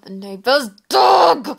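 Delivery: rising to a loud groan; real exasperation. Real, not acted. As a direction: burst out, leaning hard on "dog". Exasperated at barking dog.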